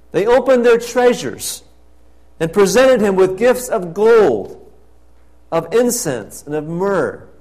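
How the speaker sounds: loud, ringing pulpit proclamation; fervent preacher's call; preaching at full force. Acted. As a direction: proclaim it to the whole church. Loud preaching about wise men.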